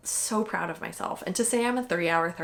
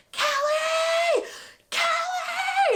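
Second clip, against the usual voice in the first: high shouted name from the crowd; retold shout; 4 dB louder, much slower than usual. Sister calling to the runner from the sideline, high shout. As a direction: call her name across the street. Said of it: "I start hearing".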